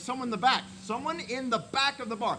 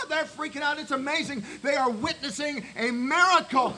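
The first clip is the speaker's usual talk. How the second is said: ordinary delivery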